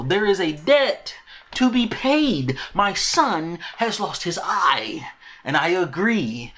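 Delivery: slow, high, emphatic acted outrage; indignant demand. Mother demanding the debt be paid, theatrically indignant. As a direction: hit each word like a wronged mother. Acting Alicent demanding payment.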